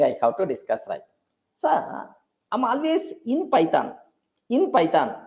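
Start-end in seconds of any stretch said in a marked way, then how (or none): 1.6-3.9 lightly voiced student, pitched up; a hint of a student pleading with sir; mock student. A student tells the teacher about Python, lightly voiced.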